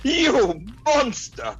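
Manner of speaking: high and very slow melodramatic cry; hammed-up outrage. Captive prince decries the villain's cruelty, slow mock outrage. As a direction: stretch it out in mock horror. [name] reacts to no open bar.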